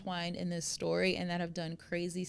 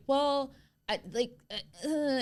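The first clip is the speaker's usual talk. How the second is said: higher, hesitant stammering; a touch of panicked caller; flustered stammer; 5 semitones higher than usual. Retold or imitated. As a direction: fumble for words on the phone. Headmaster stammering to 911.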